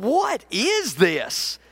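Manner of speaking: drawn-out, baffled question; slow, acted incredulity; appalled disbelief. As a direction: stretch each word in disbelief. Retells his shock at the sludge.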